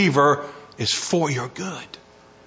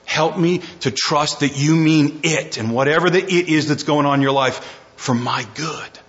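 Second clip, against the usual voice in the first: normal talking voice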